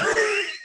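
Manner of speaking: squealing laugh; high laugh. Real, not acted. High laugh after religion.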